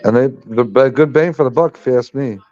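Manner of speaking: ordinary talk, nothing marked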